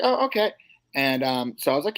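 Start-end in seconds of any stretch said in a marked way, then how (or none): 0.0-0.5 surprised. Retold or imitated.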